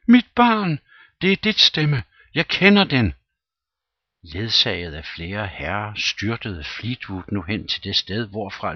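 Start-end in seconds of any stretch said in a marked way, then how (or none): 0.1-3.1 panicked shriek, much higher and louder; frantic cry. A narrator's reading. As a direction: scream for your child in terror. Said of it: "råbte missus Fleetwood". (panisk skrig)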